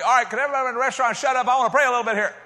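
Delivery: loud, high, rushed call to a whole room; mock attention-grabber, demanding silence to pray; hammed-up public announcement at speed; show-off announcement. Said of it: "Not like that. Not trying to show off".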